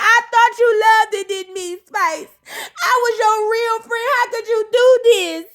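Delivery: mock crying whine. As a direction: sob it out to your friend. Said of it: "she was like".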